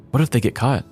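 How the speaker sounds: ordinary talk, nothing marked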